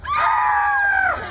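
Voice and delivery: shrill nineties movie scream; high scream. Acted. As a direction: hands on cheeks, scream high. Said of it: "They scream".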